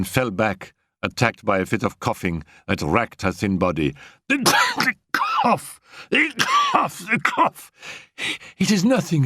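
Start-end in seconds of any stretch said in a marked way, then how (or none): 4.3-7.7 high, broken cries between coughs; coughing fit. Acted. Annette racked by coughing, high broken cries. 8.6-9.2 high, breathless denial; gasped. Acted. Annette to Raoul, high gasping denial. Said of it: "she gasped".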